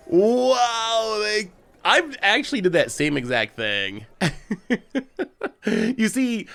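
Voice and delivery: high, laughing through the words; cracking up at the misplay. Real, not acted.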